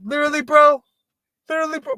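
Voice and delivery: very high bro voice; mock bro. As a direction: squeal it like a bro. Continuing the bro impression.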